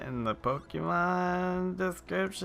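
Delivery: high, slow whiny voice. Pretend. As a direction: whine it out, high-pitched. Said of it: "that whiny ass voice".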